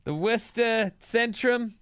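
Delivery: put-on slow Boston accent drawl. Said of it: "It's excellent".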